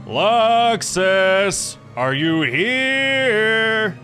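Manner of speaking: high, very drawn-out holler; sing-out shout for a missing friend; searching call. Acted. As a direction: call out loudly, stretching the last word. Second call searching for Luxus.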